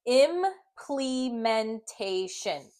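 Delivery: very slow, syllable by syllable "Implementation"; sounding out slowly. Shown as a demonstration. Teacher sounding out the word for students, very slowly. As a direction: stretch out each syllable. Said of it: "And I'm gonna blend it".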